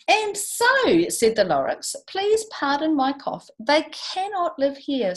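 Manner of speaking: lively read-aloud rhyme; storybook reading voice; story narration. A narrator's reading. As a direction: read it to a class with bounce. Reads Dr. Seuss aloud.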